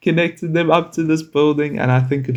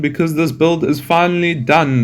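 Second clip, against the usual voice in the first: announcer voice; 4 semitones higher than usual. Pretend. Grand announcement after time lapse.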